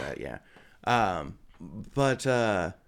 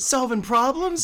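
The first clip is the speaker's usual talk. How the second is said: squirrel person voice; 6 dB louder, slower than usual. Acted.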